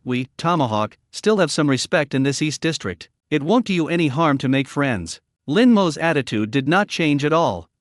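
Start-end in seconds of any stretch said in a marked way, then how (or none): none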